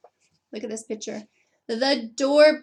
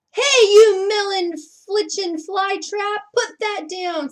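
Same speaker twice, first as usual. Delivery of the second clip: put-on high, loud old farmer yelling; angry old man; 7 semitones higher, 7 dB louder than usual. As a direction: holler at the frog thief. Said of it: "said an old man with a pitchfork".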